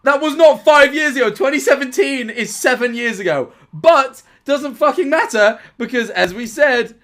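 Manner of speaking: loud and high, vindicated; I told you so energy; excited rant. Real, not acted. Triumphant ranting about being right.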